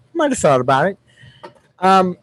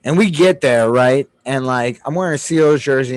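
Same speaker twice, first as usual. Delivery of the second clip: normal talking voice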